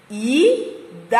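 "Idade" split into I, da, de with gaps; syllable-by-syllable. Shown as a demonstration. Teacher joining syllables for the class, spaced out. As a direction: sound out "Idade" one syllable at a time. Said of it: "a junção dessas sílabas".

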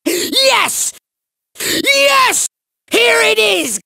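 high, excited villain exclamations; exultant bursts; gloating triumph. Acted. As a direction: crow over your evil find. Villain gloats over his find.

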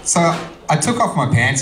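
ordinary delivery

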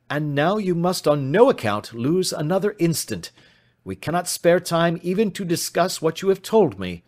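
high-pitched, pressing insistence; urgent command. Acted. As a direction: urge him on without delay, pitch up. Detective urges client to hurry.

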